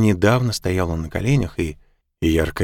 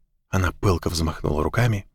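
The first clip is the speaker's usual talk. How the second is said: ordinary delivery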